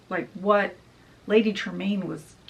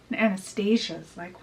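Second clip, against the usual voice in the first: ordinary delivery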